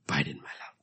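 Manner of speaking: abide breathed unvoiced and soft; hushed echo of the call; whispered. Shown as a demonstration. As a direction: whisper "Abide in my love" intimately. Repeated phrase, whispered.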